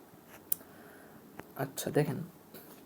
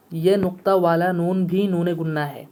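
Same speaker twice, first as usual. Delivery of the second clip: ordinary talk, nothing marked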